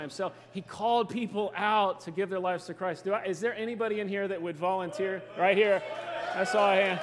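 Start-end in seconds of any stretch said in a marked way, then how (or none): 5.4-7.0 loud call across the room. Real, not acted. Preacher calling out to a volunteer in the crowd. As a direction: shout to spot the raised hand.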